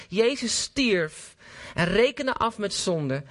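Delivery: ordinary talk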